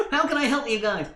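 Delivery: nerdy voice. Acted. GM voices nerdy NPC greeting.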